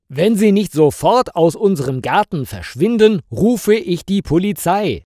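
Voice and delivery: serious. A narrator's reading. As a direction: threaten the intruder in earnest. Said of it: "sagt Alina ernst".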